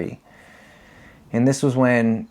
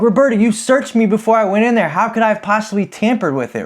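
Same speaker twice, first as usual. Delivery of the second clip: higher, louder mock outrage; protesting innocence to probation officer, higher and louder; indignant protest; 9 semitones higher, 3 dB louder than usual. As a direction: argue your innocence with raised pitch. Retold arguing with officer.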